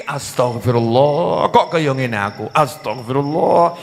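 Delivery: low, drawn-out penitent lament; acted soul-searching regret; remorseful repentance.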